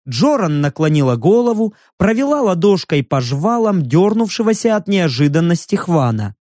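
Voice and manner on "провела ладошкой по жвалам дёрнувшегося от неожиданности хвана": usual speaking voice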